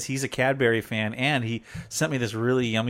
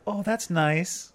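slow, higher, unbothered mock voice; imitating mellow wife; 5 semitones higher, 3 dB quieter, much slower than usual. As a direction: draw it out, blissfully unconcerned. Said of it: "I'm not quite that mellow".

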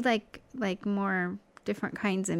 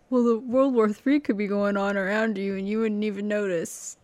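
lightly put-on husband voice; mocking husband's voice. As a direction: gently mimic your husband complaining. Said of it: "I can mock your voice".

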